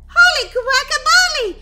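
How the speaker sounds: very high, loud exclamation; astonished shout. Acted. Sister seeing the zombie photo, astonished high shout. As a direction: explode in shock, pitch way up.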